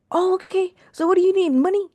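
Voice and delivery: very high, eager mother impression; hammed-up delighted eagerness; happy mom. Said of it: "she was so happy".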